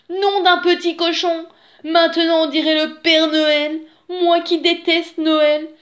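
high, loud outburst of indignation; indignant exclamation. A narrator's reading. Wolf sees himself all red in mirror, indignant outcry.

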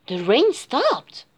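ordinary delivery, nothing marked

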